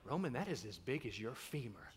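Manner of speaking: low, soft deadpan dad; acted low, quiet incredulity; hushed disbelief. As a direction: drop your voice and point out the absurd size. Dad answering son, hushed disbelief.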